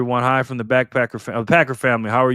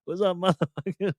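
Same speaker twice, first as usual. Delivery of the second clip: hot greeting; 8 semitones higher than usual. Retold or imitated. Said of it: "He came in hot".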